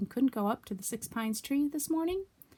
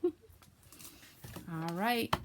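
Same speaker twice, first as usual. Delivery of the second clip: plain reading voice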